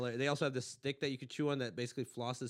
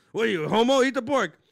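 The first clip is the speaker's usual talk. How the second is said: loud, raised Texas dad; put-on Texas accent; 7 semitones higher, 12 dB louder than usual. Dad pushing pork on son, mock Texas bullying. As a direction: badger like a hammed-up Texan father. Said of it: "I don't know what that voice was".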